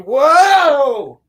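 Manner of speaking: loud, high drawn-out whoa; hammed-up cry of someone toppling over; falling yell. Reviewer acts the girl going over the cliff, mock falling yell.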